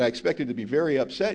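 normal talking voice

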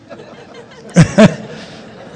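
high, loud burst of laughter; real laugh breaking out mid-thought. Real, not acted. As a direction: crack up helplessly. Cracks up over untold story.

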